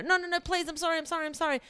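high, rapid string of apologies; imitated pleading. Imitating the friend begging her to go on, pleading. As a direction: beg in a high, repetitive rush.

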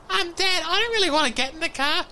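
put-on high, quick little-kid voice; reluctant child. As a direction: mimic a kid timidly objecting to Dad. Voicing a reluctant child to Dad.

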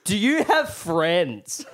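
slow, high, pointed question; retold blunt challenge. Drunk Zach butting in on the Irishman, blunt challenge. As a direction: space it out as a mocking challenge.

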